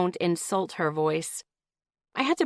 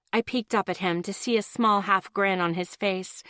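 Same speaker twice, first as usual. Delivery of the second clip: ordinary delivery, nothing marked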